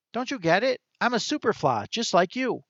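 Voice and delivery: high, quick squirrel voice pitched well up; chirpy little animal voice for the squirrel; talking squirrel. A narrator's reading. Narrator voicing the squirrel to Chester, high chirpy squirrel.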